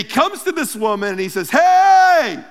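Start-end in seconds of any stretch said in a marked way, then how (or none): 1.5-2.4 a sudden loud, high "Hey" to get her attention; a bright, friendly hail; playful greeting call. Acted. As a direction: call out to her across the well. Jesus calls to the woman.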